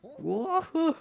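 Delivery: very high gnome whoop breaking into a cackle; high, loud mock gnome hoot; imitating the gnome sound. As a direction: attempt the gnome's "whoa" and laugh, far above normal pitch. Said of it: "It's such a specific sound".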